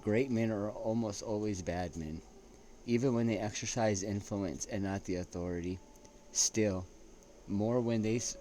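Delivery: slow, weighty reading of a quotation; reading a quote aloud. Acted. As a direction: read it out like a solemn citation. Reading a quote by Lord Acton.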